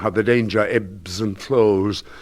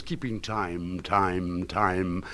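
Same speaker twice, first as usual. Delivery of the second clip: the refrain dropped to a soft echo; 3 semitones lower, 8 dB quieter, much slower than usual. Acted. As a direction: fade it like a distant echo. Same refrain echoed quietly.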